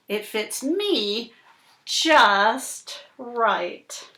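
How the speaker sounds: slow, dramatic picture-book reading; reading to children. Acted. Reading the fish story to Cooper, slow and dramatic. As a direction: draw the little listener into the story.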